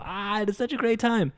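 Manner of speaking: mock blissful gush; blissed-out sigh. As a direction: float it out like pure bliss. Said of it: "And I go".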